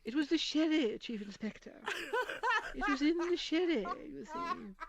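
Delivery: put-on high, tiny elderly lady voice; a frail, dainty old spinster murmuring; little old lady sleuth, high and slight; Joan Hickson as Miss Marple. Shown as a demonstration. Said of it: "Joan Hickson as Miss Marple".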